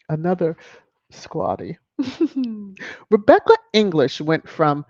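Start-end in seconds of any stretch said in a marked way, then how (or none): 2.0-3.0 short falling laugh; amused chuckle. Real, not acted. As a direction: laugh it off lightly. Laughing at a headline.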